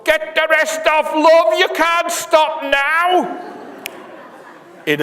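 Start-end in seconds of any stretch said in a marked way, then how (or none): 0.0-3.4 put-on much higher and louder, a drunken roar; bellowing howl. Sam bellows at the dancing witch, drunken howl. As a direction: bellow it out across the graveyard, carried away. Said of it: "His voice rings out in a bellowing howl".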